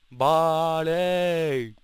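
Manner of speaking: playful calling out. Pretend. Player puzzled by a useless item, silly drawn-out cry. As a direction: call it out long and silly.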